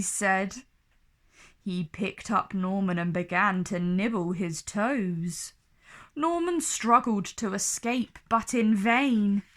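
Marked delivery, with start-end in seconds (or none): none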